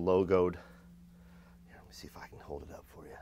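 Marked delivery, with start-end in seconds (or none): 1.7-3.1 fast, whispered aside. Real, not acted. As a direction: mutter it under your breath quickly. Aside while holding item up.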